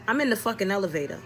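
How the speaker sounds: normal talking voice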